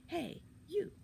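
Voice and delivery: high squeaky call from a treetop; high-voiced kid hailing someone. A narrator's reading. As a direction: call down to a stranger. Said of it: "The tree seemed to be calling to her".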